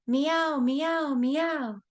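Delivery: high meowing; cat meowing. Pretend. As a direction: meow like a kitten. Cat sound in kids' rhyme.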